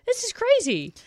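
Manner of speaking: high, strained exasperation; aunt overwhelmed by kids in the car, high and exasperated. Retold or imitated. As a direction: pitch it way up, at wits' end.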